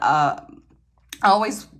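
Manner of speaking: ordinary talk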